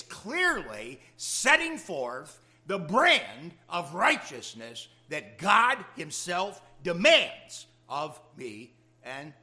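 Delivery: loud, slow pulpit emphasis; preaching at full force. Acted. Preacher pressing God's demand for righteousness on the listeners, slow and loud. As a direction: drive each phrase home slowly.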